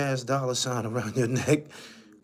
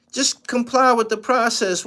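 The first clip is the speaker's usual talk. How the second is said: high, loud insistent shout; emphatic shout; 10 semitones higher, 9 dB louder than usual. Real, not acted. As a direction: hammer the point home. Repeats advice louder, higher.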